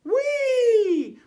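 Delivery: high, loud squeal of joy; Shelley flying through the air; gleeful whee. Acted. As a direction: squeal with delight.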